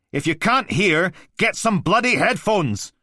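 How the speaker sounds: high, loud angry yell; furious shout. Acted. Blakey shouting at the Mantis about the noise, furious. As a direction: tear into him.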